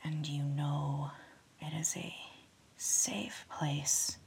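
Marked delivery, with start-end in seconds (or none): none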